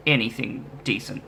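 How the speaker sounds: normal talking voice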